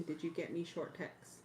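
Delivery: ordinary delivery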